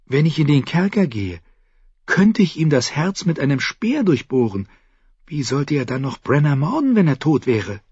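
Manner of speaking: put-on a determined girl plotting; lightly voiced girl, resolute; girl Mira. A narrator's reading. Mira proposes killing him.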